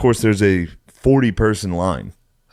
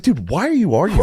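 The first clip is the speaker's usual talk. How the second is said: exasperated; 11 semitones higher than usual. Retold or imitated. Retold argument with waiter.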